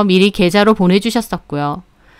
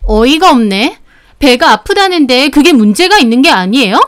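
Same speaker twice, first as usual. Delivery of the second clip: higher-pitched scoffing challenge; indignant retort; 3 semitones higher, 4 dB louder than usual. Acted. Customer with stomach complaint challenging the shop owner, indignant. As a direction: argue back with sharp rising questions.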